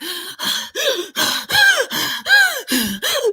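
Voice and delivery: a high hammed-up gasp of panic; mock panicked gasp. Friend pulling over in the car, mock panicked gasp. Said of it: "she's like".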